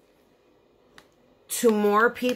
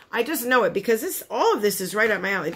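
ordinary talk

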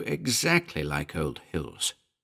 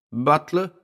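a higher, questioning call; loud call; 8 semitones higher than usual. A narrator's reading. Mellor calling for the butler, loud questioning call. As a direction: call the name out, asking. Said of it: "He had called it loudly".

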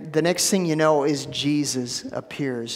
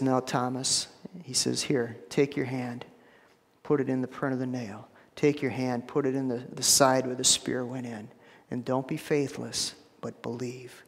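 soft, low tender invitation to touch; gentle Jesus; 5 semitones lower, 8 dB quieter than usual. Acted. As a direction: softly reassure the doubting disciple. Jesus speaking gently to Thomas.

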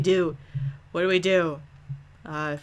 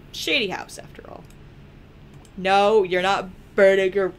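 ordinary talk

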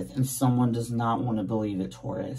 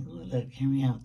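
normal talking voice

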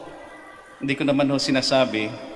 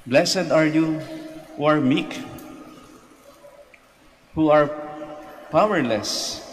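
raised, ringing proclamation; proclaiming scripture; 4 semitones higher, slower than usual. Acted. Priest reading the Beatitudes aloud to the faithful, raised. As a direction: lift the blessing up over the pews.

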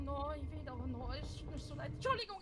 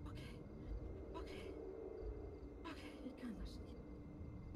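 scared whisper; 4 semitones lower, 12 dB quieter than usual. Real, not acted. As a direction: whisper your doubt to yourself. Panicking, talking to self.

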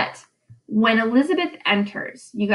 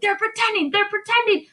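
high, urgent insistence; high-pitched desperate repeat; frantic protest; 11 semitones higher than usual. Retold or imitated. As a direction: Proctor pleading desperately. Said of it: "get really heightened emotions".

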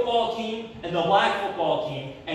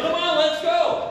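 louder and higher, pushing them to go; impatient urging; 8 semitones higher, 4 dB louder than usual. Retold or imitated. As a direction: call out come on, fed up. Urging the other driver again.

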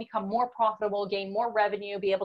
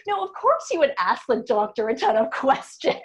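a self-mocking quote broken by a laugh; 4 semitones higher, 6 dB louder than usual. Real, not acted. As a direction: laugh through your own bad example.

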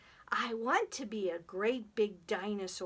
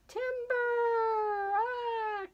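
high, long-held lumberjack call; calling timber; 13 semitones higher, 3 dB louder than usual. Pretend. Storyteller acting out a falling tree, calling timber. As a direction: stretch out a high cry as the tree topples.

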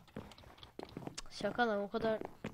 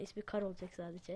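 ordinary delivery

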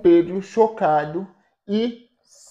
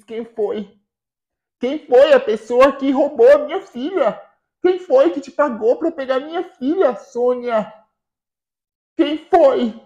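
loud, higher, fast accusing questions; furious demand; 5 semitones higher, 6 dB louder than usual. Acted. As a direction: hurl the questions at her. Mãe interroga la ladra della figlia.

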